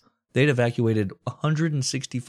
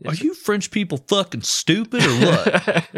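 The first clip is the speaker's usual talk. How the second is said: much higher, louder mock Churchill snap; Churchill's put-on accent; 9 semitones higher, 4 dB louder than usual. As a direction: bark it at the French in a pompous accent. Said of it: "that accent".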